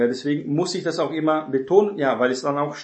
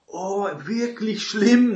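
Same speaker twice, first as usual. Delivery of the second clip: mock shocked gossip; 6 semitones higher, 4 dB louder, slower than usual. Gossip mocking an elder's fault.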